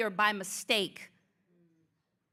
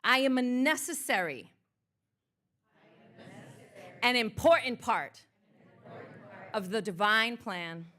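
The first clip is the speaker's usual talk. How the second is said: building affirmations. Acted. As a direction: build the declarations up line by line.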